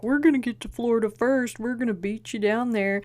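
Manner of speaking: bragging schoolyard taunt; taunting trash talk. Retold or imitated. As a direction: jeer at them like cocky boys. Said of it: "trash talking them".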